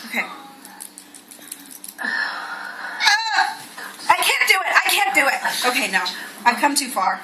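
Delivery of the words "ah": short breathless squeal of nerves; a sudden airy yelp as nerve fails; gasped cry of disgust; startled yelp. Real, not acted. Bracing to pull out tick.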